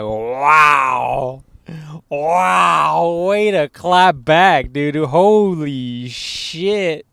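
loud, slow, stunned exclamation; stunned wow. Retold or imitated. Telling his friend how he reacted to the clip, loud and stunned. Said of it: "I was like".

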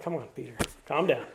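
low, soft, patient reining-in; Jesus. Retold or imitated. Jesus calms an overeager Peter, acted low and soft. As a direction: quietly settle down an overeager friend.

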